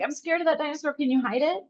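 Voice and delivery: a hint of a child asking mom for help; imitating scared toddler. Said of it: "she automatically was like".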